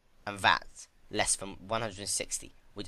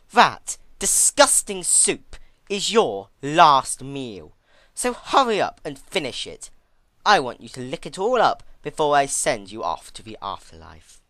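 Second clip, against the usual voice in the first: menacing threat; 6 semitones higher, 11 dB louder than usual. Acted. Twilight ordering Celestia to finish her last meal, menacing.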